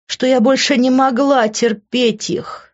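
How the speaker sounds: ordinary delivery, nothing marked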